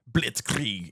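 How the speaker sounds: slow, hard German "Blitzkrieg"; mock German "Blitzkrieg"; tough German. Shown as a demonstration. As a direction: bark "Blitzkrieg" slow and harsh, German-style. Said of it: "German ... talk really tough".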